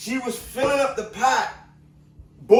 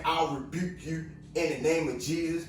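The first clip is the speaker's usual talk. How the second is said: imitating her rebuke, noticeably softer; soft quoted rebuke; 5 semitones lower, 8 dB quieter than usual. Woman quietly rebuking the cop, softly spoken. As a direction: hush it down, quote her calmly. Repeating her words to viewers.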